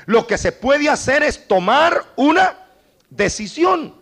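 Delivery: loud and high, driving the point home; preaching at full force. Acted. Preacher urging the flock to choose, loud and high. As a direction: call the congregation to decide.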